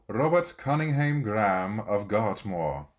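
rolled, broad Scottish accent on the name Robert Cunningham Graham of Gartmore. Shown as a demonstration. Introducing the next poet's name in a hammed Scottish accent. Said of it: "You gotta read a name like that in the accent".

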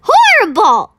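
the BFG recoiling in disgust; outraged cry; indignant outburst. A narrator's reading. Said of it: "the B F G said".

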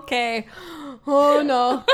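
exaggerated Minnesota accent, long round O's; loud at first, play up the Minnesota fretting; hammed-up Minnesotan dismay; terrible Minnesota accent. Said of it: "in our terrible Minnesota accents".

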